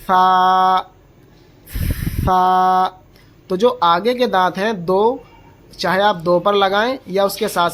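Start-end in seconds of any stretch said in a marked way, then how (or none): 0.1-2.9 long فا with soft air blown past the tongue tip at the upper teeth, no whistle; soft airy letter. Shown as a demonstration. Teacher shows students the letter فا, softly and airily. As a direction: draw out فا gently, only air hissing lightly, as th in "think". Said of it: "نرمی سے پڑھنا ہے".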